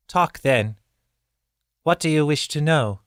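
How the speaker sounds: high, sighing surrender; resigned birdkin woman. A narrator's reading. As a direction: give in with weary pride. Zael relenting.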